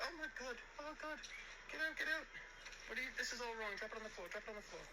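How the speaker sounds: very high imitation of a panicked woman; frantic, high-pitched mock fluster. Guest caught early by waiter at her door, mimicked fluster.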